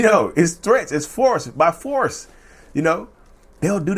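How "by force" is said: ordinary talk, nothing marked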